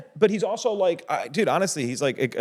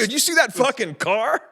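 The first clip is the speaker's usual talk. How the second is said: bro voice; 10 semitones higher than usual. Retold or imitated. Imitating himself as a bro.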